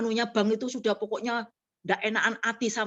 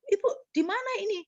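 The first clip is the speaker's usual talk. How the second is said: caller asking; 10 semitones higher, faster than usual. Retold or imitated. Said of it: "Katanya dia tanya".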